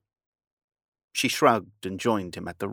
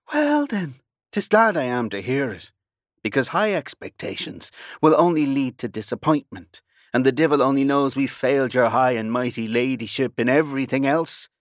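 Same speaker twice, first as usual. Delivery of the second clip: louder, higher, mocking bow of a reply; loud sarcastic retort; 5 semitones higher, 6 dB louder than usual. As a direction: throw her ladyship back at her.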